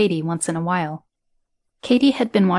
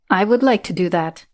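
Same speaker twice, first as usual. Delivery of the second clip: put-on Eastern European accent. A narrator's reading. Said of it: "Her accent was obviously from Eastern Europe".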